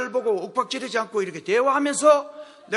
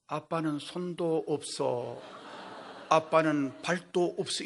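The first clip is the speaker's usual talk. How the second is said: slow, deliberate and stern; serious daughter's rebuke; 6 semitones lower, 5 dB quieter, slower than usual. Retold or imitated. Daughter scolding her father, slow and straight-faced. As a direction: lay it out slowly, straight-faced. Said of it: "딱 정색을 하고".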